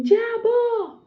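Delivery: loud, high-pitched re-enacted scream; shout. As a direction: yell it at him, holding the sound. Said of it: "eu gritei assim".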